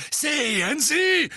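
loud, slow triumphant shout; thundering. A narrator's reading. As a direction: boom it out. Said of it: "donnerte Fudge".

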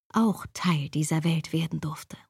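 low unvoiced whisper. A narrator's reading. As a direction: let it fade to a whisper. Narrator whispers inner hope.

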